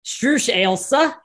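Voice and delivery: slow, careful attempt at Australian; imitated Aussie accent. Shown as a demonstration. Guest repeats the host's lesson, imitated Aussie accent. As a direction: copy the Aussie phrase, drawn out. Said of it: "your best Aussie accent".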